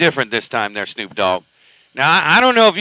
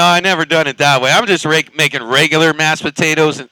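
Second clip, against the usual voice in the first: normal talking voice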